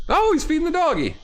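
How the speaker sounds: put-on very high sing-song baby voice; high doggy talk; cooing baby talk. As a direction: coo it like talking to a puppy. Cooing at the dog.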